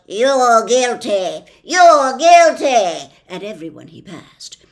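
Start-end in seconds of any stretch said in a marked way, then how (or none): 0.1-3.1 slow, loud accusing shout; policeman shouting. Acted. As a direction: bellow each word at everyone in sight. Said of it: "stopping only long enough to shout".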